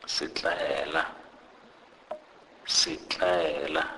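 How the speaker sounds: "sitlaela" drawn out slowly; example word demo. Shown as a demonstration. Teacher gives an example for S, "sitlaela" said slowly. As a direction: sound out "sitlaela" slowly, long "ae" in the middle.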